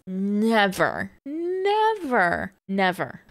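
insisting she never did such things; emphatic nevers. Real, not acted. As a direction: stress each never, leaping up on the second. Emphatic never about herself.

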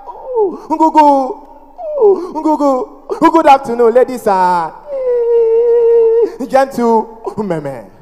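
hard blocks with long strained hoots before each word; exaggerated stammering sales pitch; mock stammer. Stammering man addressing bus passengers, hammed-up stammer. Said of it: "family members that stammer".

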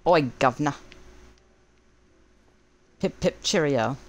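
a cheesy tourist's British English accent, all clichés. Shown as a demonstration. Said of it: "Dear's best English accent".

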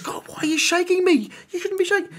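wife protesting to God while shaking, high and bewildered; bewildered plea. Retold or imitated. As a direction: protest in disbelief. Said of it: "And she goes".

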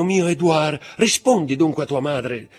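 high, loud, desperate mother; mother's cry. A narrator's reading. Mother cries out to the count for her son, loud and desperate. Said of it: "gridò la madre".

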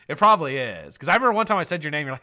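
usual speaking voice

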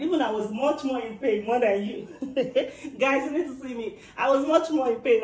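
laughing while she speaks. Real, not acted. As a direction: laugh through the words. Laughing about her pain.

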